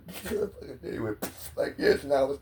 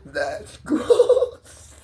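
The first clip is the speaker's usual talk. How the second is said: laughing; 12 semitones higher than usual. Real, not acted. Teller laughing at his gross banana story, laughing. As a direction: laugh the word out.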